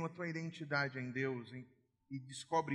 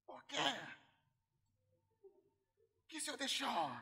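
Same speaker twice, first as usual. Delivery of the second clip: put-on high, tearful child's plea to God; pleading like a hurt little girl; daughter crying to God; 11 semitones higher than usual. Daughter alone in closet crying to God, high tearful plea. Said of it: "e ela".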